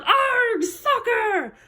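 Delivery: high-pitched pirate "arg"; pirate yell. A narrator's reading. Captain kicks off a soccer game, yelling. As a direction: yell it while kicking the ball. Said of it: "kicked the ball and yelled".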